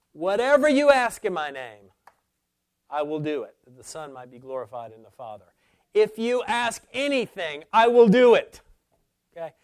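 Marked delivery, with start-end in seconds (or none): 0.1-8.6 "Whatever you ask" rung out high and loud; forceful scripture quote, "anything" and "will" punched; emphatic promise. Acted. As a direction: proclaim it, hitting "I will do it" hard at the close. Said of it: "That is emphatic".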